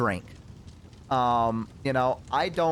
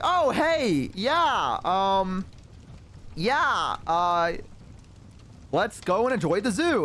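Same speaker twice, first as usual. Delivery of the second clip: higher, drawn-out awkward mock cheer; stall, then feign keenness; forced cheer; 4 semitones higher, much slower than usual. Man caught by his waiting date, forced cheer.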